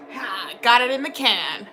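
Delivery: ordinary talk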